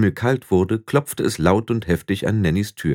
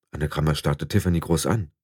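ordinary delivery, nothing marked

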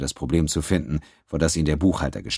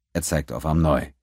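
usual speaking voice

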